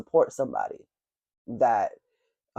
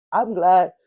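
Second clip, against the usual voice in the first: yawning; 6 semitones higher, 6 dB louder than usual. Real, not acted. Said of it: "I do apologize for this yawning".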